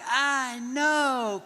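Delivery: high, long-drawn dreamy reply; slow motion. Acted. Dad basking in his boys' praise, slow motion. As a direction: stretch both words in mock slow motion. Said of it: "it was all slow motion".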